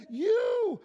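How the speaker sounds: "You" thrown out high and loud; Jesus stressing "You" to his listeners; emphatic you. Acted. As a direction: hit "You" hard and high, pointing at them. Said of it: "it's emphatic".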